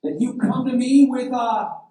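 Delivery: high, loud roar of outrage; Goliath. Acted. Goliath sizing up the boy facing him, roaring. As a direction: roar it as a taunting giant. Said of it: "he roared at David".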